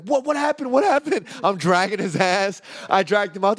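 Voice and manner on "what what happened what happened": high, confused, rushed what happened; groggy alarm, pitched high; imitating panicked friend. Friend just woken and dragged out, high panicked. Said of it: "He's like".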